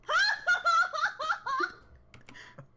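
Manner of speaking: bursting out laughing; big loud laugh; loud laughter. Real, not acted.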